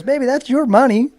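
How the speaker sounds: high mimicked lady with a laugh in it; imitated amused agent. Agent tells racer about his residuals, imitated high voice. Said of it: "And she goes".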